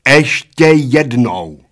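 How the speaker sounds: slow, loud, hacked-out threat; chopping each syllable. A narrator's reading. Waitress threatens the old men, chopping every syllable. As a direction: hack the words apart like a meat cleaver. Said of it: "odsekávala číšnice písmenka jako sekáčkem na maso".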